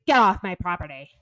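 loud first word, quick order; acting out a curt send-off; retold angry dismissal. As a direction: throw them out, briskly.

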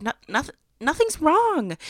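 high-pitched, stretched-out "nothing's wrong"; unconvincing denial. Retold or imitated. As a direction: deny it in a high, too-innocent voice. Retold denial to a pressing mom.

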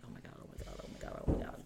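hushed, rapid mutter of dread; inner panic. Retold or imitated. Woman silently panicking on the drive home, hushed muttering. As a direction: repeat it under the breath, nearly voiceless. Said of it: "I'm freaking out in my head".

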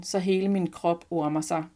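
plain reading voice

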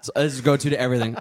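ordinary delivery

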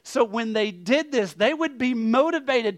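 high, driving preacher's voice; forceful preaching. Real, not acted. Preacher explaining to the congregation what the ritual exposed, forceful.

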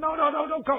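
high-pitched mock panic; panicky protest. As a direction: protest quickly as if heading someone off. Friend frantically refuses a visit.

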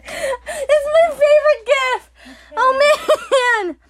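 squeaking out words through laughter; real laughing tears; laugh-crying. Real, not acted. Still laughing at funny gif.

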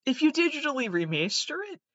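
high comic voice; mock confession. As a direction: squeak it out like a silly admission.

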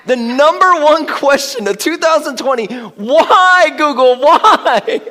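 preacher rising to a laughing climax; preaching at full force. Real, not acted. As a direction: push up to a fervent, laughing peak.

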